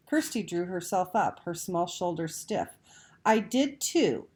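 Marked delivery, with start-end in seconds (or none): 3.3-4.3 slow, higher and louder defiant insistence; belligerent. A narrator's reading. Small girl insisting she saw the fireworks, belligerent. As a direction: stand up for yourself. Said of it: "she said belligerently".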